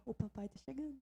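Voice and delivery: soft, hushed little voice voicing the baby; hushed baby thought. Voicing the baby's thought, soft and hushed. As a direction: whisper it as if the baby knows. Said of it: "Porque ela sabia".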